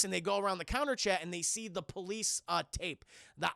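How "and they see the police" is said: ordinary delivery, nothing marked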